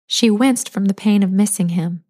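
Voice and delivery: plain reading voice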